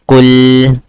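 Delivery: KUL, short u and held l, loud; correct pronunciation. Shown as a demonstration. Teacher modelling Arabic word for student, correct pronunciation. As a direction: hit KUL and hold its L. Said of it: "Say, try it".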